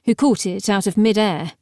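ordinary delivery, nothing marked

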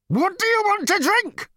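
very high, loud scream. A narrator's reading. Number two screaming at the prisoners. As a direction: scream the question in a rage. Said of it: "number two screamed".

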